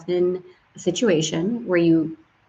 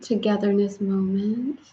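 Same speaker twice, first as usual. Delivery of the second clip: ordinary talk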